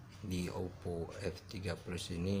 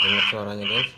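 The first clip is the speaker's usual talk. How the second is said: loud, distorted monster voice; garbled alien voice; 11 dB louder than usual. Real, not acted. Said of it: "suara monster".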